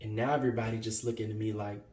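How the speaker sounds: low, soft, halting confession; quiet hurt. Acted. Young man remembering his refuge at Drew's, quiet hurt.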